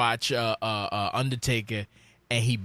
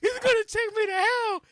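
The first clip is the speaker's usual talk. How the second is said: put-on much higher, whiny crying child voice; scared crying kid. Little boy to his dad in the dark arena, high crying whine. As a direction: imitate your younger self sobbing in fear. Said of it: "I started crying".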